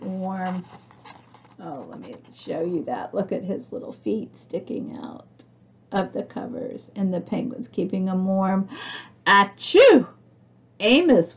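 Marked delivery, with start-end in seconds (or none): 1.6-6.9 soft whispered aside; showing the sleeping Amos, whispered. Real, not acted. Hushed aside over sleeping Amos. 9.2-10.0 loud acted achoo; storybook sneeze, loud.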